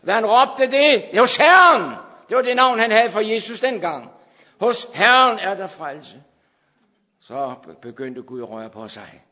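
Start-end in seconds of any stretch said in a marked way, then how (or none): 0.1-6.2 loud, high preacher's cry; preaching at full force. Acted. Preacher shouting Jonas's cry to the congregation. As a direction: shout it to the rafters.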